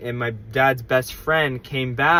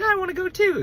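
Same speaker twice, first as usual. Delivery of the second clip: put-on very high little-kid voice; childish whine; faster than usual. Child begging to follow his dad, very high. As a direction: squeak it like an eager four-year-old. Four-year-old wanting to follow.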